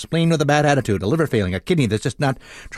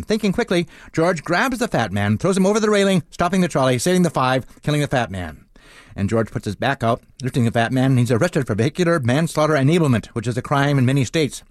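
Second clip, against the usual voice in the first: usual speaking voice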